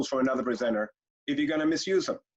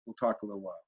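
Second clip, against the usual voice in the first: much softer and lower, calm; muted and calm; 8 semitones lower, 6 dB quieter, faster than usual. Shown as a demonstration. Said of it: "then you get more muted".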